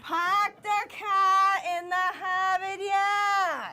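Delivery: slow, loud, hammed-up Boston accent, r's dropped; broad Boston, slowed right down. Shown as a demonstration. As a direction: shout park the car in Harvard yard as pahk the cah in Hahvahd yahd. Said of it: "No, it's Harvard".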